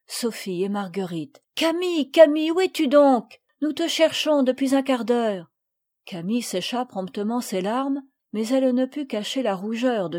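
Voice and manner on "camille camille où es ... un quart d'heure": put-on higher, louder children's call. A narrator's reading. Friends searching for Camille, calling out. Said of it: "appeler par Madeleine".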